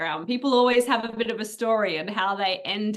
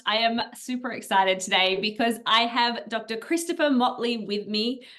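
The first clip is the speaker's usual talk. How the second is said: ordinary delivery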